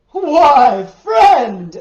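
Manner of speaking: booming call across the road. Acted. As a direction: hail them from horseback. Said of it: "the merchant called out, laughing".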